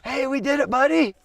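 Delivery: high, loud and thrilled; excited. Real, not acted. Celebrating the kill with his son.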